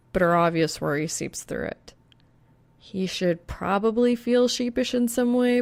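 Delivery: ordinary delivery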